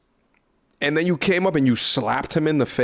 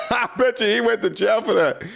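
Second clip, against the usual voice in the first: laughing through it, higher; amused laughing repeat, higher; 8 semitones higher than usual. Real, not acted. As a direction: laugh out the joke again, pitched up. Repeats line while laughing.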